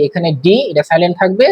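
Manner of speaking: ordinary delivery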